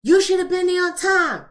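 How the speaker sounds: imitating an annoyed mother scolding her grown son; mock scolding. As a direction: scold him like a fed-up mother. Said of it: "and they get mad".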